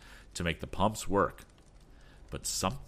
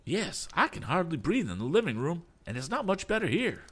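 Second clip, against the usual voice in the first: high-pitched complaint; high, worried character voice; fretful passenger; 10 semitones higher than usual. A narrator's reading. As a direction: fret about it in a raised pitch. Fretful passenger complains about stale air.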